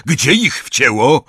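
high-pitched urgent query; calling out. A narrator's reading. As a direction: shout the question over the engine. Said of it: "zawołał Hagrid".

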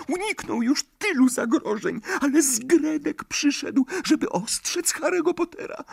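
very high, urgent elf voice; a tiny servant giving a warning; earnest house-elf. A narrator's reading. Dobby warns Harry. (przejęty skrzat)

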